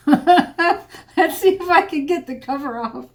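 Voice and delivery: laughing at a stuck lid; giggling through the effort; laughing while straining. Real, not acted. Lid still won't come off.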